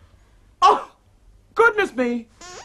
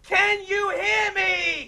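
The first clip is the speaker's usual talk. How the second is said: strained shout that hurts the throat; straining shout; 6 semitones higher, much slower than usual. Shown as a demonstration. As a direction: yell it out slowly at the top of the voice. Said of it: "don't strain your throat".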